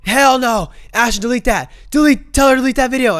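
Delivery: high, loud mock yell, racing at the end; imitating his yelling. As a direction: shout it as the furious friend. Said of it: "But just picture him yelling".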